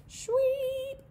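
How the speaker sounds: very high, loud tiny chirp; squeaky baby bird peep; little owl peep. Pretend. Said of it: "she peeped".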